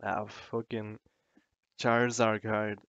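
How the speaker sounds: ordinary delivery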